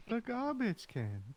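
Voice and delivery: high, slow put-on character voice; Shadow's voice. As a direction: stretch it out in a squeaky high voice. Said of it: "I can't do it in Shadow's voice".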